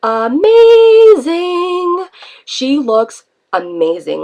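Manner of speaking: drawn-out, booming talk-show announcement; Oprah voice. Acted. Vlogger hailing her weight loss, in an Oprah voice. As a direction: stretch it out slowly, rising on the first word. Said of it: "say this in my Oprah voice".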